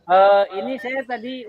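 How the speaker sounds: choppy audio dropping out; bad connection. Real, not acted. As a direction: voice breaking up on a weak call line. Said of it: "Suaranya kurang jelas".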